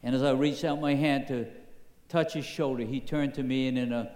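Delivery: usual speaking voice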